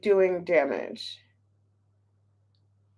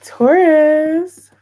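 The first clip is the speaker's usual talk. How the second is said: loud, higher call with a lift at the end; 5 semitones higher, 9 dB louder than usual. Real, not acted. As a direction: call the name out loudly. Calling out to Taurus viewers.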